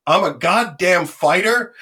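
ordinary talk, nothing marked

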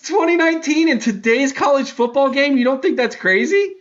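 high-pitched disbelief; indignant squeak of a defense; incredulous protest. Real, not acted. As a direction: push back in a very high, incredulous voice. Defends prediction others call not crazy.

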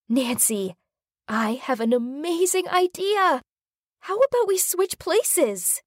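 put-on high, whiny teen girl voice; bored teen girl. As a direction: complain like a bored rich girl. Gabby voiced in story.

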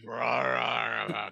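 low fading zombie rah; zombie growl. Retold or imitated. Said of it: "Except he's more like".

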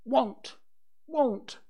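put-on high, loud little boy's refusal; high-pitched childish defiance; naughty child's cry. A narrator's reading. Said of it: "Michael cried naughtily".

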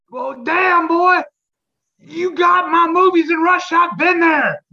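loud, high holler; big, brash imitation shouted high; Michael Rooker impression. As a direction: holler it, drawing the words out. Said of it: "how he sounds".

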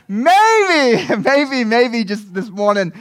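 high, laughing maybe. Real, not acted. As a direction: still chuckling at own joke. Laughs after joke.

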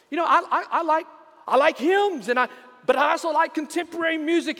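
high-pitched eager reply; eager answer. Acted. Man on rail answering.

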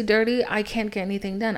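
ordinary talk

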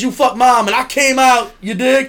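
high, loud shouted thug voice; mock thug shout. Comedian acting out the bad comic's joke, in a shouted mock thug voice. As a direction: yell it like a gangster son to his pop. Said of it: "I'd be like".

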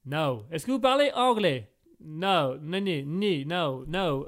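put-on low, curt no in a mild Italian accent. Examiner refuses French and English, mild Italian accent. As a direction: refuse in light fake Italian. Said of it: "tu fais vachement bien l'accent italien".

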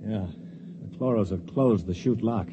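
ordinary talk

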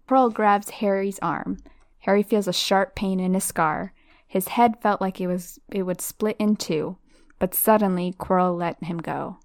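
plain reading voice

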